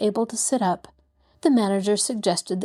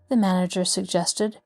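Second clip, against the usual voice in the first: ordinary delivery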